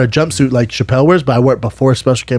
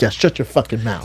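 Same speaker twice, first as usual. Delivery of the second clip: hushed gloat; 3 semitones lower, 7 dB quieter than usual. Retold or imitated. Inner gloat after co-sign.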